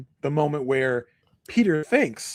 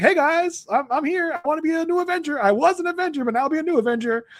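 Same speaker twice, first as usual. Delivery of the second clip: high, fast, eager mock Peter; imitating Peter eagerly asking to join the team; 11 semitones higher than usual. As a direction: pitch it up and rush it like an overeager kid. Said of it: "he's gonna walk into the New Avengers headquarters and be like".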